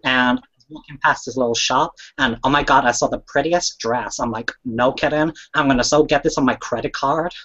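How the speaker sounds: gushing mock American shopper; American accent. Shown as a demonstration. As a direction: tell it in a put-on American accent. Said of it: "my American accent".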